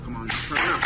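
normal talking voice

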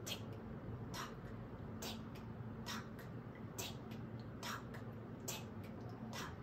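soft unvoiced ticking; whispered tick-tock. Shown as a demonstration. Reader leads children in clock sounds, whispered. Counting rhyme with kids.

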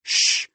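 sharp "shh" to silence a husband; wife's hushing sound; shushing. Acted. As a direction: shush him. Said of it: "Pearl shushed her husband".

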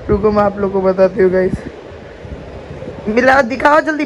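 ordinary delivery

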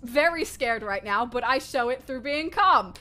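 ordinary talk, nothing marked

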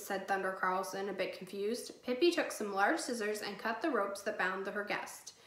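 plain reading voice

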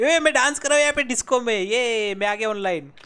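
excited game hype. Real, not acted. Gamer hypes own dance in game.

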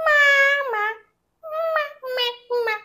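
put-on high squeaky baby toy voice. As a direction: voice the doll's first word. A toy says its first word.

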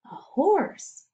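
gasp, then whisper it; hushed discovery. A narrator's reading. Reader spotting a horse in pasture, gasping whisper.

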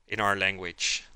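ordinary delivery

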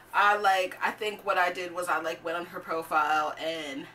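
ordinary talk

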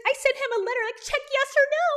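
high, loud childish mockery; mock her with a squealed, overexcited quote; giddy mocking imitation. Reactor mocking the YouTuber's first-kiss story, giddy and childish. Mocking her nine-year-old-boy crush antics.

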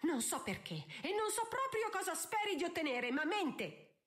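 high, agitated angry tirade; enraged accusation. A narrator's reading. Countess calling her own daughter a liar, enraged. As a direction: rail at them, pitched high. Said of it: "cominciò a tremare di rabbia".

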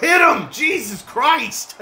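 high, loud yell, then a slow stunned curse; excited yell. Real, not acted. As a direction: shout as if cheering a hit. Big hit in game.

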